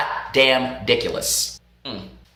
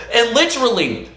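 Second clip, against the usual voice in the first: ordinary delivery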